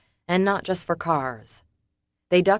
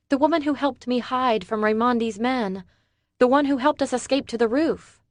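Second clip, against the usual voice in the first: higher woman's voice, explaining; Summer's voice; 8 semitones higher than usual. A narrator's reading. Summer explaining Casey to David, higher woman's voice. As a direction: tell him who Casey is, anxious.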